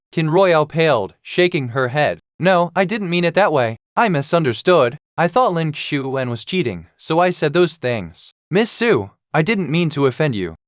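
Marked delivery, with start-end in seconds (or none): none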